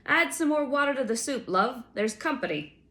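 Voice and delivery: high, loud call back; calling over shoulder. A narrator's reading. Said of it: "he called to someone".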